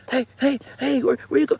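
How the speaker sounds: very high, loud mock woman calling; high shrieking mock call; calling after. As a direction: shout after someone running off.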